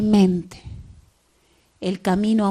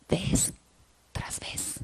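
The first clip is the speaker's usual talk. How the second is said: whisper; 11 dB quieter, slower than usual. Acted. Closing whisper.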